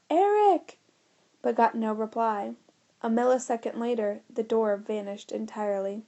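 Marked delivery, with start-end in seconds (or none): 0.1-0.8 high, loud call across the room; yelling a name. A narrator's reading. George shouting for his friend, high and loud. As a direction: George yells for Eric into the fading doorway. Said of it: "He yelled out".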